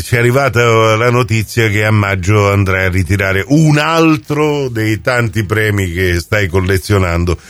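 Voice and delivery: ordinary talk, nothing marked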